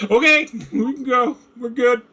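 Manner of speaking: high, squeaky mock reassurance through laughter; laughing mimicry. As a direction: parody a hasty retreat, laughing. Laughing mimic of the player's line.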